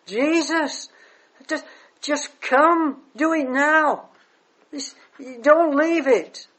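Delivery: a desperate father begging Jesus to hurry; frantic Jairus. Acted. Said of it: "you can just see Jairus hopping around, waving his arms".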